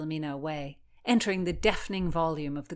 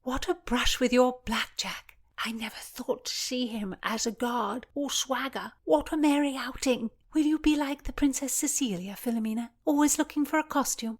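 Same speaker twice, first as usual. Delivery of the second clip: higher, merry whispered tease; teasing girl; 7 semitones higher than usual. A narrator's reading. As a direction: tease her playfully. Voices Constance.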